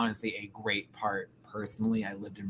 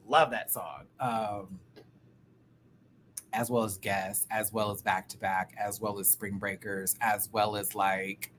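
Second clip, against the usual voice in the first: normal talking voice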